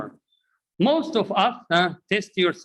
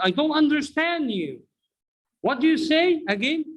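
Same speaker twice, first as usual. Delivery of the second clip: much higher-pitched bewildered questioning; baffled learner; 9 semitones higher than usual. Acted. Learner baffled by the teacher's linked phrase, high and puzzled. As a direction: protest high that you can't follow.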